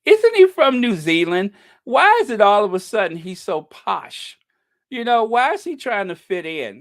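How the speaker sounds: indignant outburst; incredulous. Real, not acted. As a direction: exclaim it like you can't believe it. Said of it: "incredulous rant".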